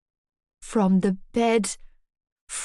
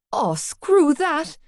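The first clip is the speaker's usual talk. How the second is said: high, slow scared outburst; panicked outburst; 7 semitones higher, much slower than usual. Acted. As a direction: bail out in panic. Panic as duvet rises.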